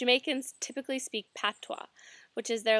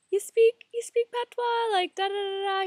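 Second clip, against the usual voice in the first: much higher and louder, amazed; astonished shopkeeper; 10 semitones higher, 5 dB louder than usual. Retold or imitated. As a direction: gasp it out, stunned she speaks patois. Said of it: "he was like".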